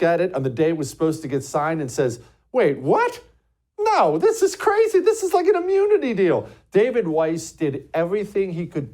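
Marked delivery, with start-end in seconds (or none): 2.5-6.5 high-pitched, disbelieving outburst; mock incredulity. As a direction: play an astonished judge balking. Acting out judge's disbelief at deal.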